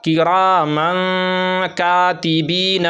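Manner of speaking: kiraman with a long nasal hum at its end; slow chant, tanween hidden and held in the nose; ikhfa on the tanween. Shown as a demonstration. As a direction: draw out the nasal n before katibeen. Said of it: "इसी तरह".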